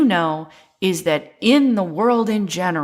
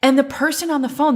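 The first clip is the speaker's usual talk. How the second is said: ordinary talk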